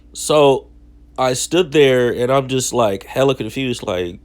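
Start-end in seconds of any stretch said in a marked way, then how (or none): none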